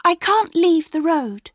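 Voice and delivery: put-on high, loud worried child; a little girl refusing. A narrator's reading.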